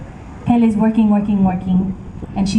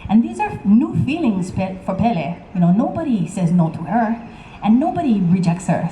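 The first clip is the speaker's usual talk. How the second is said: ordinary delivery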